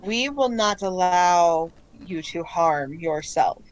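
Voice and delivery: calm collective voice. Acted. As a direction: speak evenly with long deliberate pauses. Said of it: "you just hear the voices again".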